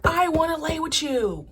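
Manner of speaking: hammed-up lovesick reality-show plea; Red from Bad Girls Club. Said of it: "that sound from Bad Girls Club".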